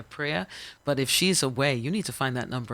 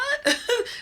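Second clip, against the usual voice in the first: sudden high laugh; high burst of laughter; 6 dB louder than usual. Real, not acted. Retelling the biker's threat, bursting into laughter. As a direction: laugh it off in disbelief.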